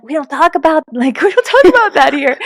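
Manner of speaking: high, loud, flustered correction; mock panicked hush. Mom hushing her son in front of his classmates. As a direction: hush the child in comic panic.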